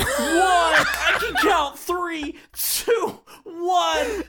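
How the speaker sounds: high, wheezing laughter through counting; can barely get the count out for laughing; laughing hard. Real, not acted. Counting down, cracking up.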